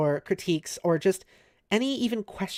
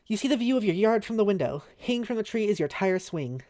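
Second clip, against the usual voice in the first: story narration; 3 semitones higher than usual. A narrator's reading. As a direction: lively narrator read. Reads game narration.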